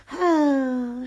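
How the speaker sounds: audible wistful sigh; a small wistful sigh; lonely sigh. A narrator's reading. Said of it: "she sighed".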